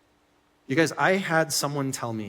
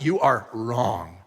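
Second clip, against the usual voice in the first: slow, lower, final; flat verdict; 4 semitones lower, much slower than usual. Retold or imitated. A husband shutting down his wife's opinion, slow and flat. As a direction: lay it down like a verdict. His closed-minded reply.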